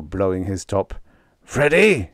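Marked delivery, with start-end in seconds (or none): 1.4-2.1 high, loud screech of a name. A narrator's reading. Manager screeching at wrestler Freddie. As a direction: shriek his name in outrage. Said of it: "he screeched".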